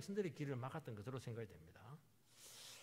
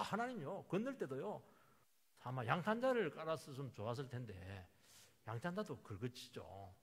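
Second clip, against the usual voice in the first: ordinary delivery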